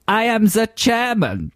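high, slow mock-German declaration; German accent. Co-host demonstrates phonetic German spelling, hammed-up German accent. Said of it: "if a German person said the word German".

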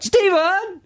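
high, stretched call for help; mock distress shout. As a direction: call the name out as if in peril. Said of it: "They shout".

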